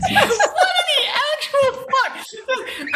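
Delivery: high, slow shriek through laughter; laughing disbelief. Real, not acted. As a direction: squeal it out in shocked delight.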